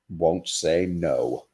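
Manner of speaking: the last line drawn out slowly and softly; a storyteller's hushed, slow finish; slow, quiet ending. A narrator's reading. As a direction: let the punchline fade out gently. Closing line of the poem.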